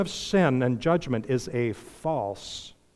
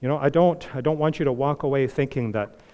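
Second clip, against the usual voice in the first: ordinary talk, nothing marked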